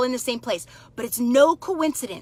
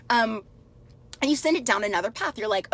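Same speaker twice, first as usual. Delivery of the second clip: ordinary talk, nothing marked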